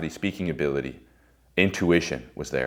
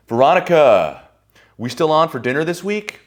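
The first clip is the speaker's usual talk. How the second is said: mock smarmy guy; 10 semitones higher, 8 dB louder than usual. Mocking the guy who greets the girl in front of his wife, high and loud. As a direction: ham up a slick guy calling her name.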